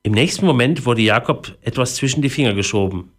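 plain reading voice

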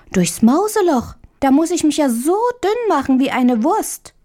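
put-on high-pitched little girl voice, doubtful; little Marie, incredulous. A narrator's reading. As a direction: pitch it up as a small girl balking at the idea. Marie doubts the mousehole plan.

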